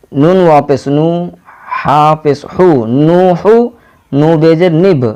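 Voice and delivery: ordinary talk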